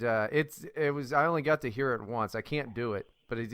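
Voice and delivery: ordinary talk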